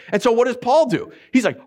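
normal talking voice

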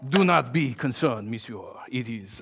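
lightly hammed-up French accent; French waiter. French waiter reassuring a diner at the table, French accent. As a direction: play a soothing French waiter. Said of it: "I don't know why he's French".

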